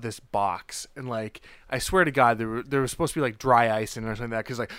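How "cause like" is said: normal talking voice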